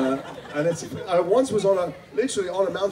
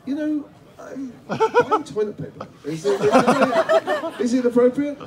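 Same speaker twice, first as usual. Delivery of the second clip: high, slow, laughing embarrassed protest; awkward reluctance; 10 semitones higher, slower than usual. Retold or imitated. Actor asked to do the voice while buying toilet paper, awkward. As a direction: squirm out of it, laughing. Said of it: "And I go".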